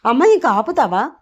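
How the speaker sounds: high, loud exasperated yell; angry shout. A narrator's reading. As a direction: shout to cut off the scolding. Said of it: "గట్టిగా అరుస్తారు". (గట్టిగా అరుస్తూ)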